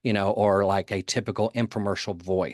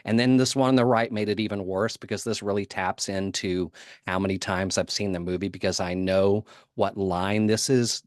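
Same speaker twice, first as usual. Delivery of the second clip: ordinary talk, nothing marked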